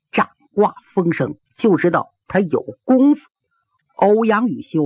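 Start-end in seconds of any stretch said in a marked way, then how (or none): none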